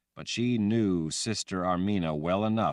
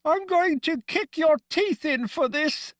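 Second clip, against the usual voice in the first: much higher, muffled furious threat; 5 dB louder than usual. A narrator's reading. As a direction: speak through hands over the mouth. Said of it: "in a muffled voice from behind the hands clamped over her mouth".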